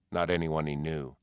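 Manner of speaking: ordinary delivery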